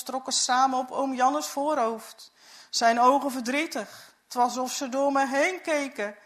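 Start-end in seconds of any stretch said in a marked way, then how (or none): none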